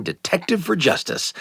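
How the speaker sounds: usual speaking voice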